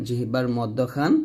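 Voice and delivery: ordinary talk, nothing marked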